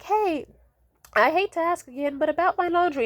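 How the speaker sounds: high, apologetic imitation of a careful request; reluctant asking. Daughter forced to ask mom again, high tentative imitation.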